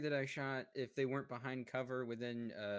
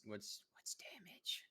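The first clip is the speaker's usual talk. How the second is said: whisper; 8 dB quieter, slower than usual. Real, not acted. Asks quietly mid turn.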